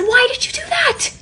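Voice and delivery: put-on a high, sharp snapped yell at the toddler; imitated frustrated yell at a daughter; retold yell. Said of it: "My immediate reaction was to yell".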